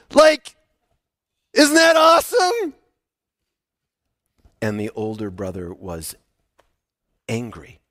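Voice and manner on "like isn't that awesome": high, loud and delighted; excited. Acted. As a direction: sell how great it is. Preacher shares servant's joy.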